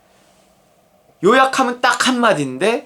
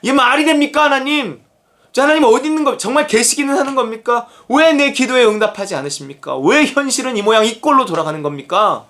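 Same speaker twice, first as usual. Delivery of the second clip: higher-pitched mock protest, settling as it goes; indignant arguing; 3 semitones higher than usual. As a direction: imitate a prophet arguing with God. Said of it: "이렇게 따지는 거예요". (하나님께 따져 묻는 흉내)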